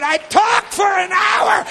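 slow, high, full-voice yell; insistent shout. Acted. Brother telling preacher of strange episode, insistent shout. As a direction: hammer out every word at top volume.

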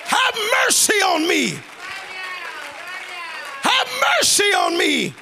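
high, drawn-out plea to God; desperate crying out. Acted. Needy believer begging God right now, crying out. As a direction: hammer each plea at full preaching pitch. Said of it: "cried out".

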